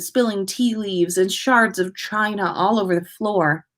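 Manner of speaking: ordinary delivery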